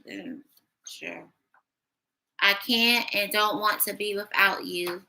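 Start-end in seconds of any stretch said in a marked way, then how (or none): 0.1-1.2 sceptical sarcasm. Real, not acted. Doubting the message.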